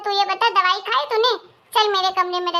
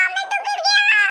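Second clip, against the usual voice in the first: put-on child's wail; 12 semitones higher than usual. Child calling to mother after a fall, high wail. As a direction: cry out high like a hurt child.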